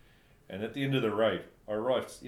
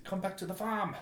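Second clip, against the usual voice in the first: high-pitched mock Afrikaans-accented English; put-on Afrikaans accent, high and eager; Afrikaner accent; 12 semitones higher than usual. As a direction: imitate an Afrikaner's halting English invitation, pitched up. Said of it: "they could hardly speak English".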